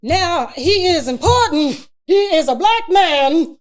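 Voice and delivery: slow, high, booming proclamation; loud, hammed-up spoken-word delivery; mock-important poet. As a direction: declaim it grandly, drawing out each word. Mocks solemn poet declaiming importance.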